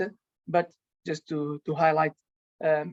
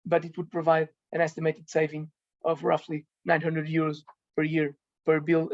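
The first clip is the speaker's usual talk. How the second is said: ordinary delivery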